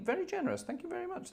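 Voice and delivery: very high soft squeak; tiny falsetto thank you; squeaky thanks. Pretend. Thanking donor.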